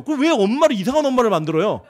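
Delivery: usual speaking voice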